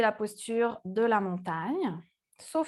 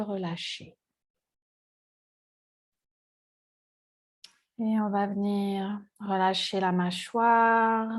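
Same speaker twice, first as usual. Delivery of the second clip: guided meditation; 4 semitones lower than usual. Acted. Yoga teacher leading seated students into body awareness, calm meditative guiding. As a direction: guide them calmly into the breath, with long pauses.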